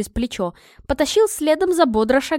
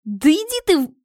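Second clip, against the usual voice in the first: higher-pitched shout, cut short; raised voice, broken off mid-curse; yelled retort; 6 semitones higher, 4 dB louder than usual. Acted. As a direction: yell it back angrily. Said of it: "проорала я в ответ".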